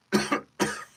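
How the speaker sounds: acted wounded cough; battered villain's cough; beat-up cough. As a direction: cough before you can speak. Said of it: "One more line with the cough".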